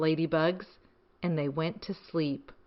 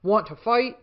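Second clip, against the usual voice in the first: grouchy ladybug; 3 semitones higher, 5 dB louder than usual. Acted. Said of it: "said the grouchy ladybug".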